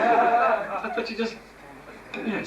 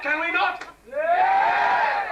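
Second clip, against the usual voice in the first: rallying shout; 7 semitones higher, much slower than usual. Real, not acted. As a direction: pump up the crowd. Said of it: "crowd call and response".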